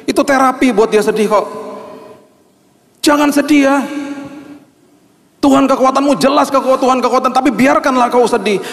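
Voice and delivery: a pastor warning mourners' visitors against empty words, fervent and high; fervent preaching. Acted. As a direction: press the point hard from the pulpit. Preaching at full force.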